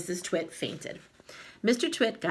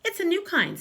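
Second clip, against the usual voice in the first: sly wife; 13 semitones higher, 5 dB louder than usual. Acted. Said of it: "said Missus Twit".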